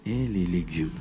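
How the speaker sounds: hushed relaxed reply; gentle home voice. Shown as a demonstration. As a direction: answer mildly and quietly. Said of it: "C'est comme ça que je parle".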